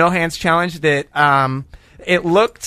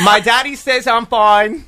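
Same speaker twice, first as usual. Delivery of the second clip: slow, higher British accent parody; exaggerated put-on British accent; 4 semitones higher, slower than usual. Shown as a demonstration. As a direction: stretch it out in a hammy British voice. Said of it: "Can you just do your British accent one more time?".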